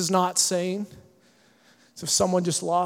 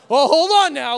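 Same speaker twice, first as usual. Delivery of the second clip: mock indignant; 4 semitones higher, 8 dB louder than usual. Reacting to being cut off.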